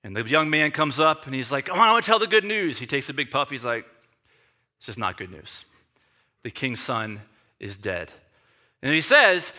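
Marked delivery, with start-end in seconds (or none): 1.6-2.8 higher, hurried eager plea; eager pleading. Acted. Young man begs his general to send him, eager and quick. 4.8-8.1 low, quiet, heavy reply; grim. Acted. General breaks bad news to the eager young man, grim and quiet. Joab after a big puff, grim.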